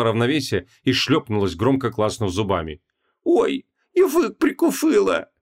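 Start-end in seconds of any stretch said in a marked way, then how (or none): 3.2-5.3 high, slow, lisping moan. A narrator's reading. Wife who clacked her teeth in a fall, moaning. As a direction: moan with a bitten tongue. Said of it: "простонала она".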